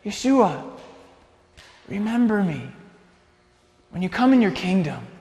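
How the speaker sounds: hushed, halting request with long pauses; earnest dying plea. Acted. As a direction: plead gently, pitch lifted at the start. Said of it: "he turned to Yeshua in the middle".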